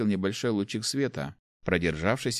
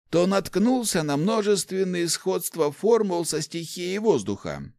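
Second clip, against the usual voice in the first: put-on higher-pitched, animated old man's voice; enthusiastic old inventor; 8 semitones higher than usual. Old inventor explains artefacts to the rector, enthusiastic. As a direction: explain your discovery eagerly, pitched up.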